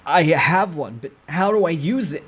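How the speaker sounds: high, loud and slow worried voice; uneasy soldier asking; anxious soldier. Acted. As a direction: plead for help. Soldier character unsure about skill.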